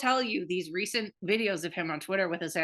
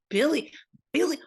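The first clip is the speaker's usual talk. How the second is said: higher, louder mock horror, name drawn out questioningly; Dean's horrified disbelief; 6 semitones higher, 5 dB louder, slower than usual. Host retells friend reacting to her celebrity crush, horrified disbelief. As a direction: imitate appalled, incredulous repeating. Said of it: "Dean was fucking low-key horrified".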